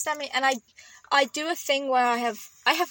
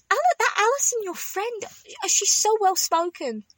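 mum's voice; 10 semitones higher than usual. Retold or imitated. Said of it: "my mother always says".

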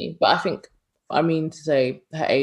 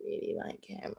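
whispered, low and very soft; imitated soft confession; 9 semitones lower, 10 dB quieter, faster than usual. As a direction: mimic her confiding in a whisper. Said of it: "She was like".

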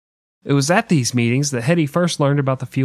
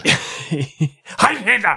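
mock Nazi salute; 6 semitones higher, much slower than usual.

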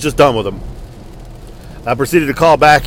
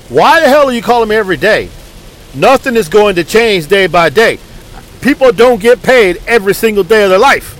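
higher and louder, fed-up complaint; exasperated rant; 5 semitones higher, 3 dB louder than usual. Retold or imitated. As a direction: let them have it, annoyed. Venting at repeat callers.

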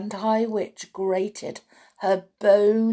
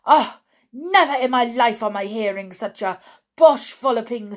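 put-on loud, higher foreign-accented tirade; raging witch; 3 semitones higher, 8 dB louder than usual. A narrator's reading. Grand High Witch berating a witch, loud accented tirade. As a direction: rage at the fool in a thick accent. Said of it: "screeched the Grand High Witch".

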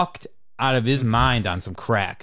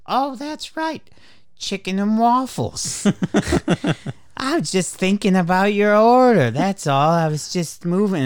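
much higher, airy flustered server voice; ditzy waitress voice; 8 semitones higher, 4 dB louder than usual. Retold or imitated. Mocking waitress fixing the plates, high ditzy voice. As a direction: play a scatterbrained waitress covering up.

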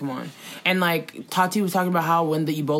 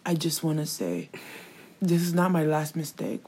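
soft, lowered, halting confession; mock apology, swallowing; 4 semitones lower, 7 dB quieter than usual. Mocking his apology video to co-host, halting. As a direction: quietly mimic his pause-filled apology. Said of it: "you can hear him, like, swallowing".

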